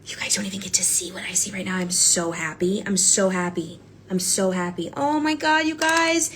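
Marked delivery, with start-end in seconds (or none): 0.1-1.8 whisper. Real, not acted. Secret aside to viewers.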